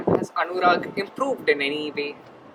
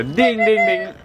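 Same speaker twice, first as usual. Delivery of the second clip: correct answer ding; 5 semitones higher, slower than usual. Pretend. Imitates the correct-answer chime.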